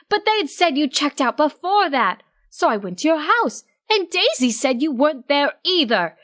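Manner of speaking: worried friend chewing him out; Octavia scolding. Acted.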